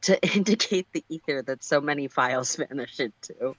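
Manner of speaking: real giggles breaking the reading; laughing through it. Real, not acted. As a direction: read it while cracking up. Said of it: "Oh my goodness".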